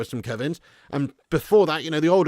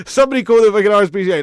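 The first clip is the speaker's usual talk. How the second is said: much louder, fast hammed-up shout; mock outraged shout; 5 semitones higher, 11 dB louder, faster than usual. Streamer jokingly yells for help over a viewer's dog joke. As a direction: shout it like a fake emergency call.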